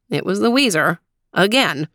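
plain reading voice

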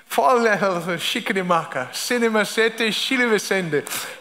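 short amused laugh; real laugh at own slip. Real, not acted. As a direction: laugh it off warmly. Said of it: "laughs at own misreading".

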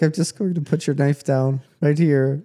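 playful mock movie impression; Will Ferrell. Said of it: "He's like".

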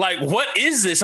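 mock streamer voice. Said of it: "the streamer's like".

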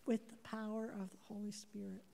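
soft breathy whisper, barely voiced; hushed, intimate whisper. Real, not acted. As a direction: lean in and whisper it like a secret. Confiding aside to the congregation.

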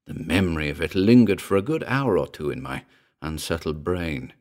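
ordinary delivery, nothing marked

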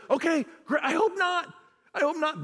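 high, mock-nervous hope. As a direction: squeak out a nervous hope, half joking.

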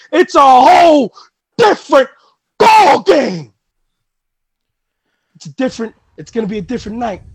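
heated promo to a rival; fired-up wrestling promo, pushing hard. Acted. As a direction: talk trash to the champ before the big match.

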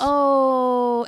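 high, loud, drawn-out mock "oh"; long sing-out of exaggerated excuse-making; mocking fake piety. Speaker mimicking Bible pick-and-choosers, mocking drawn-out tone.

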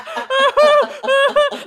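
loud, high fake boo-hoo sobs; mock crying, hammed right up; ham-acted crying. Witness faking practised tears in court, ham-acted sobbing. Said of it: "like, ham acting type".

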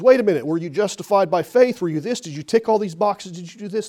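loud, fast, demanding interrogation; angel questioning. Pretend. Angel at heaven's gate grilling the thief, loud and fast. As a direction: fire the questions at him like a stern gatekeeper.

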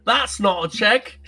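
hammed-up Australian accent; mock Australian quote. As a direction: riff on the movie line in a fake Aussie accent. Riffing on the knife line.

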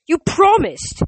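loud, high-pitched accusation; angry outburst. A narrator's reading. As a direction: cry out, hurt and furious. Nico accuses narrator, glaring.

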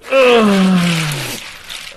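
sharp gasp at the icy cold; pained gasp. Real, not acted. Hand plunged into ice water.

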